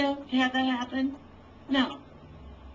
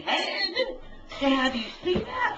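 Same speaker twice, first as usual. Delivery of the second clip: angry crying; 8 semitones higher than usual. Real, not acted. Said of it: "I'm mad".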